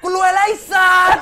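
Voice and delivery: high, very loud yell; whooping announcement of sudden wealth; jubilant shout. Retold or imitated. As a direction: re-enact screaming it down a jammed road. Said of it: "กูตะโกนลั่นเลย".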